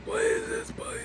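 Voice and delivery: high whine of protest; squeaky complaint. Real, not acted. Sister squeaking again about the spicy drink.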